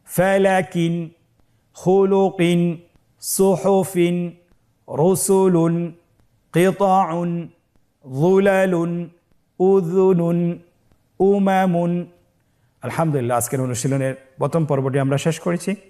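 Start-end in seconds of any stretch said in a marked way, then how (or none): 0.0-12.0 clear, separate reading of each word; teacher calling out words; word list drill. Shown as a demonstration. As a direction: read out for class to repeat. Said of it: "আপনারা আমার সাথে বলুন".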